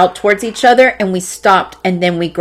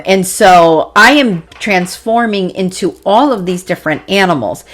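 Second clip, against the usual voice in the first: ordinary talk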